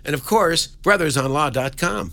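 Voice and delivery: bright, high radio promo read; radio announcer. Acted. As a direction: sell it like a station plug. Radio promo plug over music.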